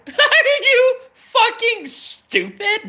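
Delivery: high, slow mock outrage through laughter; incredulous mockery. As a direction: laugh through a squeaky, stretched mock scolding.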